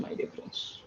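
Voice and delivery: ordinary delivery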